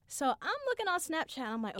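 usual speaking voice

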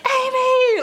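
high mock guard's shout; retold shout. Guard chasing someone between tables, imitated high shout. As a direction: call out the name, imitating a guard. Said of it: "One of them is shouting".

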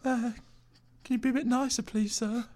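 high, soft polite plea; timid Subway server. Acted. Server pleading with the rude mafia boss, high and soft. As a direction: ask nicely in a meek falsetto. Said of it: "Subway server".

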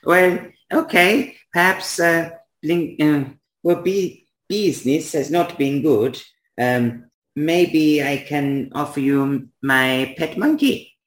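high, slow bargaining voice; hesitant toymaker Blinksy. Acted. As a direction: haggle haltingly as a high-voiced odd shopkeeper.

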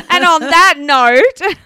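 loud and slow through laughter; laughing. Real, not acted. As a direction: crack up while saying it. Laughing wrap-up.

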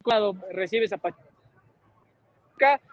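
usual speaking voice

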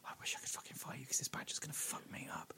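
tortoise whispering its defeat to the badger; resigned tortoise. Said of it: "a tortoise looking at it going".

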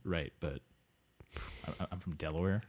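lost, muttering guest; Delaware Dave. Acted. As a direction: drone it like a confused guest. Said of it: "first time on a podcast".

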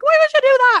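loud, very high, sing-song outrage; high-pitched musical anger. Shown as a demonstration. Comic showing how her anger comes out musical, high-pitched anger. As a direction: protest angrily in a shrill, lilting squeal. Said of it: "I go more high pitched, it sounds more musical".

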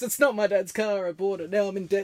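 high, loud wailing complaint; mock tearful confession. Housemate confesses his car debt over a beer, mock distraught. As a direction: imitate a distraught mate, half laughing. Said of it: "he's crying and stuff".

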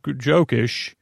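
normal talking voice

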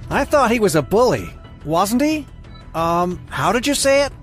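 high, sneering character voice. A narrator's reading. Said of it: "Aiden sneered".

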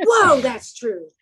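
loud, drawn-out "whoa" of realisation; mock surprise. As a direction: act out suddenly catching on, slowly. Retold sudden realisation about payment.

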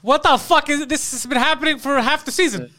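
high, exasperated disbelief; mock outrage. Executive producer spots the tattoo mid-season, outraged. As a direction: burst out as a baffled boss.

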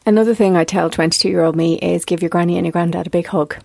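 usual speaking voice

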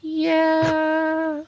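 high, drawn-out little sloth voice; tiny sloth child, very high and slow; slow baby sloth. Acted. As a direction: stretch it out like a sleepy-paced baby sloth. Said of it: "You talk really slow".

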